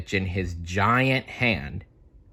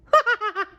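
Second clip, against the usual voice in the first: high cartoon giggle; 4 dB louder than usual. Character laughs.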